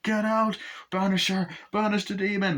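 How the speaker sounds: high, urgent banishing cry; mock exorcism command. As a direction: order the demon out dramatically. Exorcism acted out.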